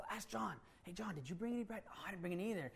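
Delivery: softly hushed, trying not to be overheard; whispering behind the teacher's back; hushed disciples. Acted.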